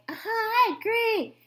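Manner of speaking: high animal voice. A narrator's reading. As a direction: chirp a quick yes. Animals agree.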